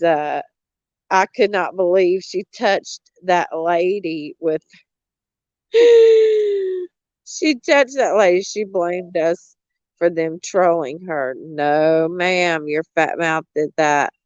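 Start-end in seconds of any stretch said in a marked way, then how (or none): 5.7-8.4 much higher, slow gasped disbelief; shocked gasp. Real, not acted. Shocked gasp at what happened.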